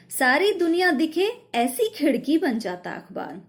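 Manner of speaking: poem recitation. Shown as a demonstration. Teacher recites poem line again. (कविता पाठ)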